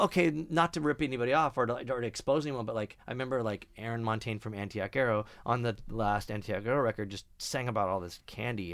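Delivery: usual speaking voice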